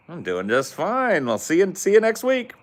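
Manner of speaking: a hint of a British goodbye, lightly done; mock British accent. Gamer saying goodbye to his American friend in a mock British accent. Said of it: "this British thing is hard".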